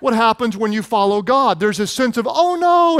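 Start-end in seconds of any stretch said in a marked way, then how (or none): 2.3-3.0 1 mock fright. Sermon mimics child's fear.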